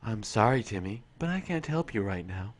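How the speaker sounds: low, quiet, eerily calm computer; deadpan machine refusing the astronaut; HAL 9000 voice. Retold or imitated. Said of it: "Hi, Hal".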